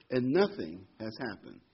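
ordinary talk, nothing marked

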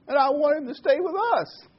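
put-on high, loud child's plea; sobbing little boy. Boy in the joke pleading to keep his baby brother. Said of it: "the boy sobbed".

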